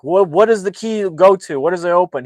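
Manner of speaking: imitated interrogation. Recapping Russians questioning their captive, imitated loud demand. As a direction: act out an impatient interrogator.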